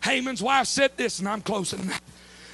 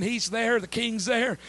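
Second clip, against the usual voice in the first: normal talking voice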